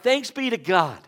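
loud, grateful outburst; relieved exclamation. Retold or imitated. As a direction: exclaim it like a heartfelt prayer. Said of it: "And I thought".

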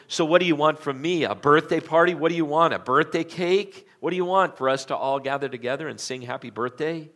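loud, high and mean, mocking her; nasty tone. A narrator's reading. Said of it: "responded in kind of a nasty tone".

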